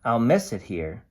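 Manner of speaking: lively storybook voice for a can's diary; cheerful picture book narration; reading to children. A narrator's reading. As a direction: read it bright and animated to a class. Reads picture book aloud.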